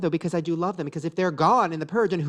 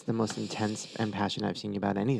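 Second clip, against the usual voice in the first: whisper; 6 semitones lower, 6 dB quieter than usual. Real, not acted. Whispered comment.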